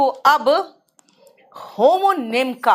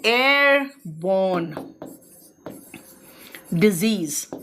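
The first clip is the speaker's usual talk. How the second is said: Airborne drawn out very slowly, syllable by syllable; dictating airborne disease slowly to students; slow dictation; 4 semitones lower, much slower than usual. Shown as a demonstration. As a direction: spell out airborne slowly for notes. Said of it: "ऐसे लिखते हैं".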